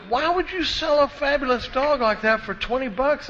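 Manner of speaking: can't believe the price, pitch way up; incredulous disbelief. Retold or imitated. Buyer baffled by the cheap dog, high incredulous squeal.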